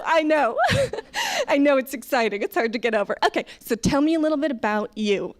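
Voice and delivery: over-friendly waitress hyping up the table; gushing server. Acted. Acting over-excited American server.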